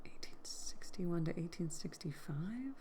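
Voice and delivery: hushed, half-whispered question to yourself; near-whisper, rising in disbelief; doubtful murmur. Real, not acted. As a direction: repeat it under your breath, questioning it. Repeats looked-up dates in doubt.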